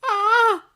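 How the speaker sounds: delighted high gasp; gushing squeal. A narrator's reading. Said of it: "gushed in a stage whisper".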